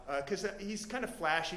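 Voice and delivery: plain reading voice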